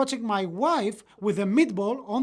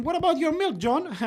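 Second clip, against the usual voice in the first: higher-pitched mocking reminder to an old friend; teasing; 8 semitones higher, 4 dB louder than usual. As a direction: rib a friend playfully. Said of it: "we remember telling him".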